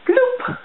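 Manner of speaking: high squeaky bloop; bloop sound. Retold or imitated. Child mimicking a pacifier splashing into the ocean, high bloop. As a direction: pop it out like a toy dropping.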